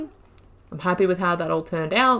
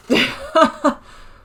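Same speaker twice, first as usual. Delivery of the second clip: breathy laugh; 9 semitones higher, 7 dB louder than usual. Real, not acted. Laughing at own confusion.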